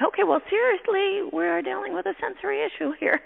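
high-pitched comic aside; mock exasperation. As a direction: pitch it way up, on the edge of laughing. Retold exasperated reaction, laughing after.

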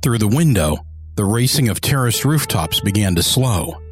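low, measured audiobook narration; story narration. A narrator's reading.

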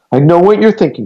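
put-on frightened girl calling to the man inside. A narrator's reading. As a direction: call out urgently. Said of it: "she called out".

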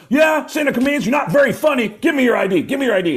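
high-pitched mock irritation; scornful cop. Cop dismisses the comedians and wants I D, irritated. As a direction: snap orders at a suspect, unimpressed.

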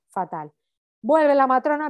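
normal talking voice

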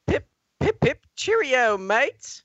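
British impression. Host asked for a British impression, hammed-up slow mock British accent. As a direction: play a cartoon British toff. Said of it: "give us an impression".

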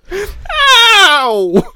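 very high, loud yelp; cry of pain. Acted. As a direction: yelp like a struck boy. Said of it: "Rick cried".

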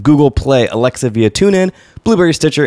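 normal talking voice

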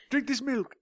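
hammed-up, badly faked British accent; comically bad British accent; terrible British accent. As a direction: do an awful mock British voice. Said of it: "worst British accent in human history".

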